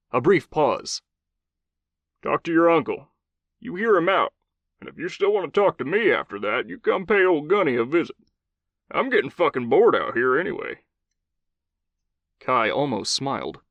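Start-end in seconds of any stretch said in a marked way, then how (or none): 2.2-10.8 gruff Southern commander, higher voice; old Southern cowboy voice. Acted. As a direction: play a blunt old cowboy soldier.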